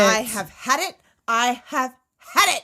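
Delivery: put-on loud, fed-up child-star outburst; bratty tantrum. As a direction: punch it out like a spoiled brat. Acting the bratty child star's tantrum.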